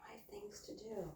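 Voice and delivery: very soft, voiceless murmur; near whisper. Real, not acted. Woman telling pet she has chores, whispered. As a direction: barely voice it.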